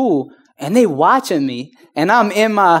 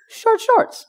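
squeaky emphasis. Real, not acted. Mock emphasis.

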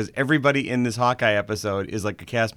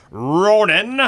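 drawn-out, loud, higher announcer flourish; dramatic title call; 9 semitones higher, 7 dB louder than usual. Acted. Host announcing the episode title, dramatically drawn out. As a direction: stretch the title out big.

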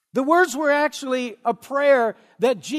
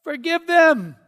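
loud, higher, ringing cry to the Father; calling out in prayer; 5 semitones higher, 8 dB louder, slower than usual. Acted. Preacher as Jesus answering the shouting crowd, loud plea. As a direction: cry out over a crowd, stretching the first word.

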